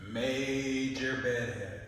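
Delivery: very slow, loud stretched words; slow, sustained mock-important naming; drawn-out announcement. A narrator's reading. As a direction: stretch each word like a grand technical term.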